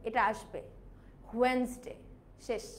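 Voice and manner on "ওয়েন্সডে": ওয়েডনেসডে as "wens-day"; correct pronunciation. Shown as a demonstration. Teacher repeating the proper form, silent-D pronunciation. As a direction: drop the D from ওয়েডনেসডে. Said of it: "আমাদের এটার উচ্চারণটা হবে".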